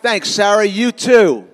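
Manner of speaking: loud, sweet-sounding mock reply; a sugary loud text reply; cheery reply. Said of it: "what I wrote back".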